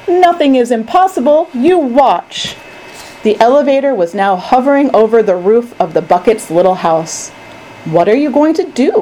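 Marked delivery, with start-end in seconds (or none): 0.1-2.6 high, emphatic assurance; confident cry. A narrator's reading. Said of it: "cried Mister Wonka". 8.0-8.9 high, anxious question; alarmed cry. A narrator's reading. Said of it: "cried Charlie".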